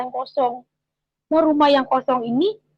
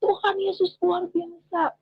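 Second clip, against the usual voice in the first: retold exclamation; 7 semitones higher, 4 dB quieter, slower than usual. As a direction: cry out in praise, slow and high, without real volume. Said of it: "Makanya dia teriak".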